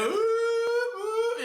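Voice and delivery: high hooting imitation of battle horns; mock horn hoots. Preacher mimicking horns blowing on the battlefield, high hoots. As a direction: hoot like horns in the chaos.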